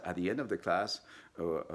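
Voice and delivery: ordinary talk, nothing marked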